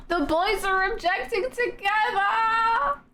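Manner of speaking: high, drawn-out excited squeal of words; gushing onlooker. Acted. Onlooker thrilled by the double objection, gushing. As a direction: gush it slowly, way up high. Acts out excited game character.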